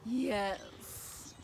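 dragon. A narrator's reading. Dragon answers in story.

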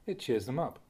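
ordinary talk, nothing marked

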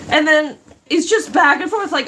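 ordinary delivery

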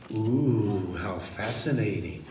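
low, soft oohing; mock fascination. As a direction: murmur in feigned awe. Mock audience awe.

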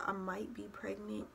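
near-whispered, hedging admission; hushed and cautious; tentative confession. Retold or imitated. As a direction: breathe it out softly, imitating a scared confession. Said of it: "still beating around the bush".

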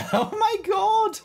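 high, drawn-out squeal of disbelief; shocked laughter. Real, not acted. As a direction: squeal it slowly through the giggles. Stunned after being shot.

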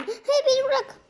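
high, pleading squeaky voice; a small creature whining at the cannibals; frightened little creature. Acted. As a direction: beg for your life as a tiny captive. Miniş caught before being eaten.

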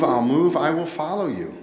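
usual speaking voice

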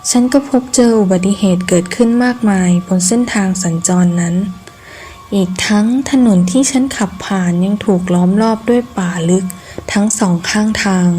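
ordinary delivery, nothing marked

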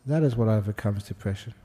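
ordinary delivery

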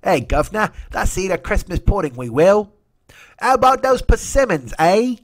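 high hammed-up English gent; mock old English voice. Mocking English idiots planning pudding, English toff voice. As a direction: lilt it like a cartoon Englishman. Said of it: "I don't know who's doing that voice".

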